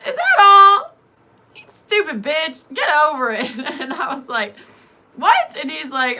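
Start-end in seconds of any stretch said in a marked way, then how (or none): 0.1-3.5 loud, high mocking outburst; exasperated babysitter. Babysitter reacting to her screaming, loud exasperated imitation. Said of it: "what accent I was doing". 5.2-5.6 loud, high, indignant yelp; acted shock. She reacts to being insulted, shocked and loud.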